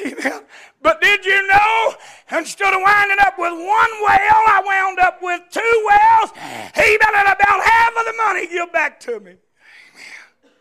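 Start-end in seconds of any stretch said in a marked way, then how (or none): 0.8-9.3 loud, ringing preacher's cry; full-force preaching. Acted. Preacher telling the congregation about his blessing, full-force preaching. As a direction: drive it home to the pews.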